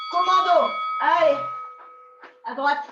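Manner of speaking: loud call to the class. Real, not acted. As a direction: shout the next move. Coach calling next exercise.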